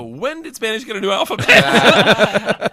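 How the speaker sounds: high, slow, laughing disbelief; mock bafflement. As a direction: play up the confusion. Retold baffled question.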